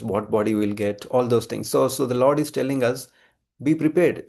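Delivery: ordinary delivery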